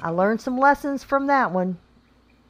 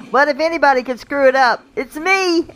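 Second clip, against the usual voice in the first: self-mocking exclamation; 7 semitones higher, 6 dB louder than usual. Real, not acted. Joking about own clumsiness.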